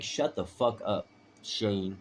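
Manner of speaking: slowed, weighty delivery; slow and deliberate. Shown as a demonstration. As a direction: lay each word down slowly. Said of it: "I, oh, I say it like".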